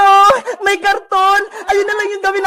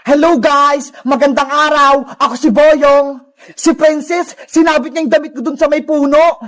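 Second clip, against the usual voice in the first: lower-pitched character voice; boy character Boyong; 4 semitones lower than usual. Acted. Boyong greeting viewers about his cardboard clothes, lower character voice. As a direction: play Boyong in a lower register.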